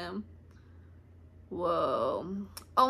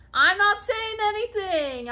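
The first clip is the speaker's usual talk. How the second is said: high, loud protesting whine; insistent denial; 10 semitones higher, 6 dB louder than usual. Real, not acted. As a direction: deny it loudly with the pitch shooting up. Repeats her denial more emphatically.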